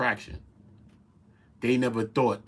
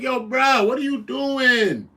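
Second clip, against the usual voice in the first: disbelief; 13 semitones higher, 5 dB louder, slower than usual. Retold or imitated. Reacting in disbelief.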